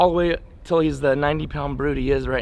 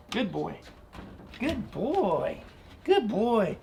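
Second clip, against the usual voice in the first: higher, sing-song dog praise; praising the dog; 5 semitones higher, 8 dB quieter, much slower than usual. Real, not acted. Trainer praising Rocky after a good fetch, high happy praise. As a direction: gush over him like a proud owner.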